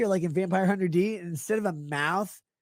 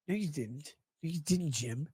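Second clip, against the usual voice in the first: hushed mock scolding; 5 semitones lower, 8 dB quieter than usual.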